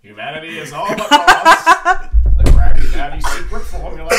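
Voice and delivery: very high, loud, hammed-up cartoon villain; Plankton. Host hamming up the line for laughing friends, cartoon villain impression. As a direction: belt it out as a scheming villain. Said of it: "I'll read it in Plankton's voice".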